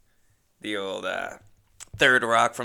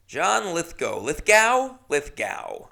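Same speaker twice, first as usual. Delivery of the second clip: lightly voiced Lithgow, Lithgow? drawn up high then dropped; mock the actor's way of saying John Lithgow; imitating John Lithgow; 5 semitones higher, 3 dB louder, slower than usual. Podcaster mimics how John Lithgow talks, light impression. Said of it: "He just talks like that".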